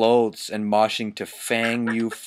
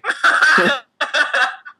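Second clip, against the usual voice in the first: shrieking laugh, much higher; 5 dB louder than usual. Real, not acted. Cracking up at the fanfic, shrieking laugh. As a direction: burst into a high cackle.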